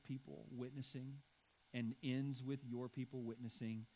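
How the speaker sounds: hushed pulpit prayer. Acted. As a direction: pray quietly to the Father. Pastor prays before sermon.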